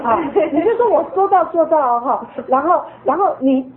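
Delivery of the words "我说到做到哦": mock stern, louder promise; firm warning. Shown as a demonstration. Mother shows parents how to warn a child firmly. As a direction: lay down the law to the child. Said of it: "你都先给他这样吓唬嘛".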